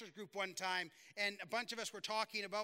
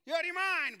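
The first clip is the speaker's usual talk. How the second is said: louder and higher, calling out the excuse in disbelief; incredulous shout; 5 semitones higher, 5 dB louder than usual. Retold or imitated. Recovery group members calling out a friend's excuses, incredulous shout. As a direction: yell it like you can't believe what you just heard. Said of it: "everybody goes".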